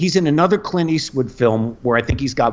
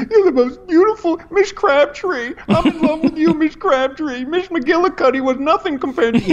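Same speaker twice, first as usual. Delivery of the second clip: little rascal. Retold or imitated. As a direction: swoon like a smitten schoolboy. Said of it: "become a little rascal".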